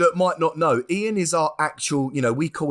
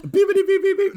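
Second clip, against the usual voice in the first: loud, much higher beep beep; high mock censor beeps; 4 dB louder than usual. Host says every time you talk it will bleep, high beeps. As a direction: squeal the bleeps. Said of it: "it's gonna go, beep".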